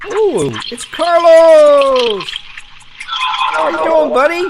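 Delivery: high, loud, drawn-out squeal of delight; excited greeting. Real, not acted. Streamer spotting a regular viewer in chat, excited high squeal.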